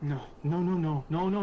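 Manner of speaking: acted distress, building as it repeats; distressed denial. Iku looks up at her dead friend, in distressed denial. Character reacts to friend's death.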